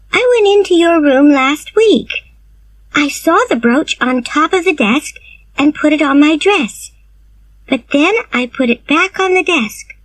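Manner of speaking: put-on high, eager girl's voice; young Anne. Anne answers Marilla in story.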